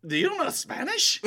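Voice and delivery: mock Mexican accent. Retelling a Mexican diner's question to him, Mexican accent. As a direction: lightly put on a Mexican accent.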